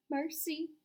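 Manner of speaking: high, loud panicked cry for help; frightened cry. A narrator's reading. Ladybug crying out in panic as the giant descends. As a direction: cry out in terror. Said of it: "cried the ladybug".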